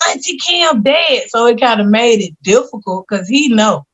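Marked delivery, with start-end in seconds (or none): 0.1-1.3 little cousin's voice. Retold or imitated. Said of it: "He was like".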